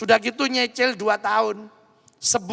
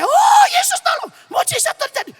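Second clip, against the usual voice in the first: much higher, loud joyful scream; 9 dB louder than usual. Retold or imitated. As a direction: shriek it like a miracle just happened. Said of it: "Yuli teriak-teriak".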